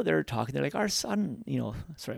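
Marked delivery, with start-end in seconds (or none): 0.7-1.4 lightly voiced elderly parent, higher; a hint of a sweet old mother; old person voice. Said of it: "I'm putting on the old person voice".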